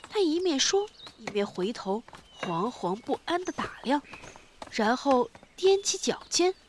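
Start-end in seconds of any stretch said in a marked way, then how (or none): none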